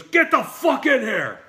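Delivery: high, loud angry bark; Burt Reynolds yelling. Retold or imitated. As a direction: mimic a furious star ordering people in. Star yells from trailer door.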